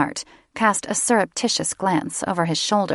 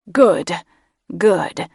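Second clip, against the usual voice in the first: louder, satisfied purr; the mage savouring good news; the mage approving his creature; pleased mage; 5 dB louder than usual. A narrator's reading.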